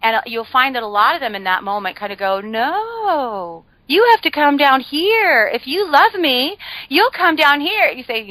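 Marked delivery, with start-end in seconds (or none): 2.4-7.9 higher, slow, needy guilt-trip voice; mock whiny pleading. Family member begs you into the pit, slow mock whine. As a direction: whine it out like a clingy relative.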